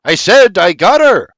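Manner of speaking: loud repeated shout; shouting back. A narrator's reading. Sir John shouting back at deafened William, loud. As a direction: hammer it out for a deaf ear. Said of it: "Sir John shouts back".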